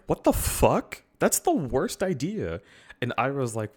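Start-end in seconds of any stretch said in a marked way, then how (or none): 0.1-2.6 higher, exasperated mock outrage; incredulous outburst. Zuko reacting to Iroh's plan, exasperated. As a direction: act appalled at a dumb plan. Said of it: "Zuko's like".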